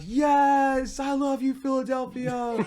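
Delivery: high, drawn-out re-enacted holler; imitating an ecstatic scream to a whole city; retold screaming. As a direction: holler it like you're running through the streets. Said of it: "screamed at the top of my lungs".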